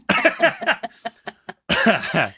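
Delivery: rough cough clearing the throat; choking cough. Real, not acted. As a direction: hack out the stuck crumb. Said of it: "I'm choking on a piece of toast".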